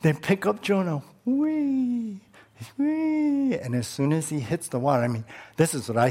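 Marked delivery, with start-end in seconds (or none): 1.2-3.6 high, gliding mock whee as he flies; playful whee. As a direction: sing out a childlike whee, pitched high. Mimics Jonah being thrown.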